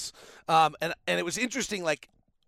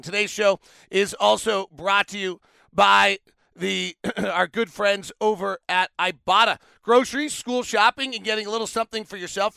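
upbeat sponsor read, a bit louder; 3 semitones higher, 5 dB louder than usual. Acted. Host reading a sponsor ad for listeners, upbeat. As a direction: sell it like a radio spot.

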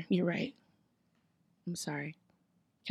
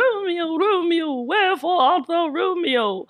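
put-on high, slow, wavering old woman call; old Juliet calling; 8 semitones higher, slower than usual. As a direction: call up to the balcony like a frail grandma. Juliet balcony scene, acted.

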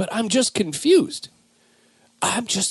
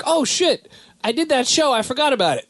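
Buck realizing; 7 semitones higher than usual. Retold or imitated. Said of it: "maybe he listens and is like".

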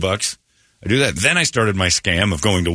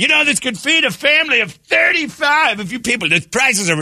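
mock outraged shout. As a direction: shout it like the bill is a scandal. Standing up ranting at restaurant.

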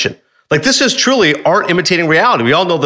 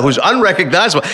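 usual speaking voice

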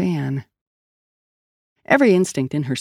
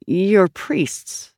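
mildly incredulous, pitch raised; doubtful, slightly higher; skeptical; 4 semitones higher than usual. A narrator's reading. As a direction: question it with open doubt.

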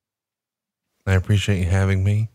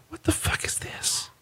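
breathy unvoiced whisper; 10 dB quieter than usual. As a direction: hiss the question under the breath. Whispered aside in the rant.

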